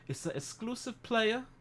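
high put-on voice, slow and aloof; high, slow mock-posh brush-off; mock snooty reply. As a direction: play the snob brushing off the question. Imitating their curt reply.

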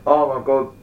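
spluttering after spitting a drink; choking on a drink. Real, not acted. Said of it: "[name] just spat his drink all over".

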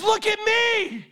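high, slow, ringing; commanding call. Acted. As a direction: demand attention. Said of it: "He wasn't saying".

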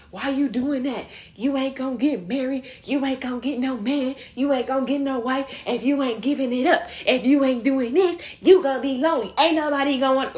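mock a pushy friend shaming someone; hammed-up scolding voice of a judgmental friend; nagging critic.

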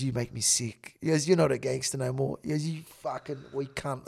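low, soft retold insults; retold contempt. Retelling insults over the podcast, quiet contempt. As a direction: put him down quietly, pitched low.

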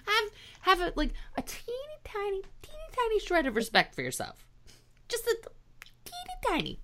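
put-on very high teeny voice; high squeaky plea. High mock plea for respect.

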